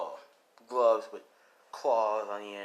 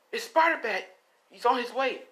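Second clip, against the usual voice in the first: news reporter; 12 semitones higher, 6 dB louder than usual. Acted. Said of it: "as New's like".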